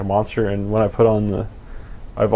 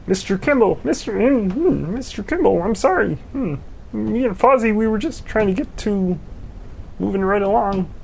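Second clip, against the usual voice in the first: much higher, nasal-free cartoon puppet voice; 10 semitones higher than usual. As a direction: do a high Muppet-style voice. Puppet character voice, mentions Fozzie.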